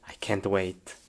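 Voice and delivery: breathless whisper of excitement; eager whisper, almost no voice. Acted. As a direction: hush it like a secret you can't keep. Excited kid whispering to himself.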